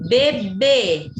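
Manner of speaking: "Bebê" loud, with a long last syllable; emphatic demo, weight on final BÊ in "Bebê"; stress on final BÊ. Shown as a demonstration. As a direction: punch and hold the second syllable of "Bebê". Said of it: "A sílaba tônica é a última".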